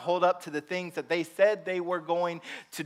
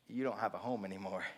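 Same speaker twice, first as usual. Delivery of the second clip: soft bad news; 11 semitones lower, 14 dB quieter, faster than usual. Telling neighbor bad news.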